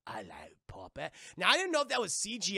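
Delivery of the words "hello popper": much lower, soft sinister voice; creepy low greeting. Retold or imitated. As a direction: purr it like a monster at the door. Yellow-eyed pirate greets her.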